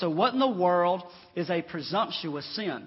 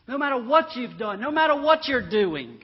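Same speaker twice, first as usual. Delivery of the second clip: louder, higher pulpit insistence; emphatic preaching; 7 semitones higher, 9 dB louder than usual. Acted. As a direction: drive each phrase home to the congregation. Preacher insisting nobody is excepted.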